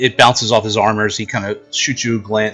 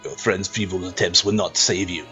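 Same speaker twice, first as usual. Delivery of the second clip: gravelly sly hobgoblin; 4 semitones lower, 8 dB quieter than usual. Acted. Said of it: "He says".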